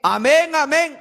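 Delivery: "Amén, amén" called out loud and rising, like the whole crowd; loud demo amen. Shown as a demonstration. Preacher showing the church how to answer loudly. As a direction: show them the lively answer "Amén, amén". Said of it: "¿será que nosotros respondemos así también?".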